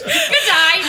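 high mock Australian good day; Australian accent. Host slips into Australian while trying British, mock Australian. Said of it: "No, that's Australian".

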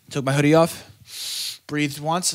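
normal talking voice

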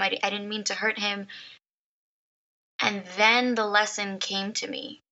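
normal talking voice

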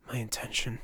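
breathless inner whisper; whispered thought. Acted. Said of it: "Turner thought".

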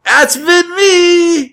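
high stretched-out shout; hammy shout. Pretend. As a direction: belt it out slow and high. Sign-off joke as husband.

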